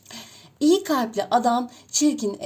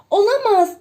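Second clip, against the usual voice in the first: high, loud cry of shock; exclaiming; 9 semitones higher, 9 dB louder than usual. A narrator's reading. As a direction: cry out in disbelief. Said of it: "diye haykırır". (yüksek sesle şaşkın haykırış)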